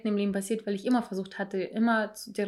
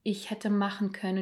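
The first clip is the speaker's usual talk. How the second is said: ordinary talk, nothing marked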